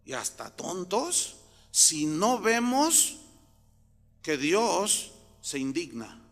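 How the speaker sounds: ordinary delivery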